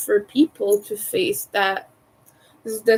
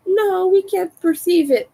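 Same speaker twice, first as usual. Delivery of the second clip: dismissive reassurance; 9 semitones higher, 4 dB louder than usual. Retold or imitated. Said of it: "that's very dismissive".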